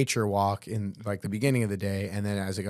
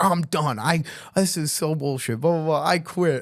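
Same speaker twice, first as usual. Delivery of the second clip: high, louder put-on huff; mock angry quitting; 6 semitones higher, 3 dB louder than usual. Drunk bandmate quitting the band over a prank, high mock outrage. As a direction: ham up a drunk storming off.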